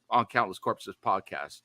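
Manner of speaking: usual speaking voice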